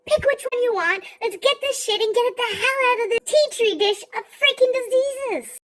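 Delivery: high exasperated yell of "Stop!"; freaking out. Retold or imitated. Granddaughter yelling at indecisive grandma, high exasperated outburst. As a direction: snap at her, pitch high and pleading. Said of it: "So I'm freaking out".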